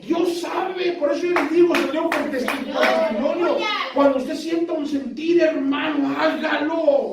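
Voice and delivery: high, urgent pulpit exhortation; fervent preaching. Real, not acted. Preacher urges the brothers to act on the heart's prompting, fervent preaching. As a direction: preach it high and driving, stretching the last words.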